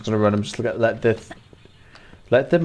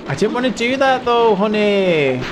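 much higher, sugary apologetic voice; cutesy apology; 10 semitones higher, 4 dB louder than usual. Acted. Gamer soothing the monk she shot, high and cooing. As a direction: coo it like soothing a child.

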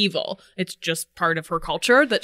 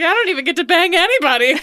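laughing complaint; 6 semitones higher, 5 dB louder, faster than usual. Retold or imitated. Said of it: "It's like".

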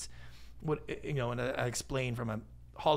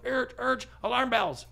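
higher, louder mock panic at a pushy seller; mock alarm reaction; 9 semitones higher, 4 dB louder than usual. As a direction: play the alarmed buyer, pitched up. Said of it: "people are like".

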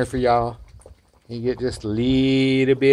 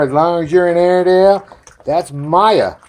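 ordinary talk, nothing marked

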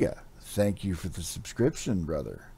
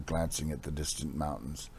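ordinary delivery, nothing marked

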